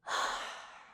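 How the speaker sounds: long, breathy exhale; disappointed sigh. Acted. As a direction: sigh heavily through an open mouth. Krampus disappointed at being unrecognized.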